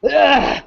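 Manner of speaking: high, strained creature groan; pained dragon groan. Acted. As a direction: groan loudly like a stumbling beast. Game master groans as stumbling dragon.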